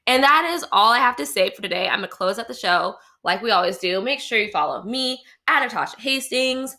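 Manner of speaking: normal talking voice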